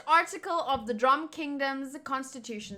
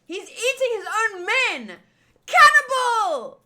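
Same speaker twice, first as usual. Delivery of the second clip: horrified outcry; 9 semitones higher, 10 dB louder than usual. Acted. Henchman horrified by his king eating men, screaming. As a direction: yell it in shock and disgust.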